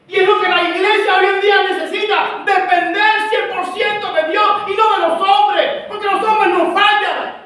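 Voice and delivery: loud, high, driving sermon rebuke; full-force preaching. Acted. Pastor rebuking the church about fasting, preaching loudly. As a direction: preach it hard at the congregation.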